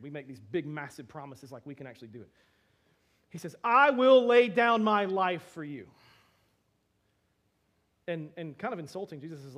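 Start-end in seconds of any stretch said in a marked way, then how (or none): 3.6-5.9 loud, slow, fervent promise; earnest loud vow. Acted. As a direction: swear it with everything you have. Peter vows loyalty to Jesus.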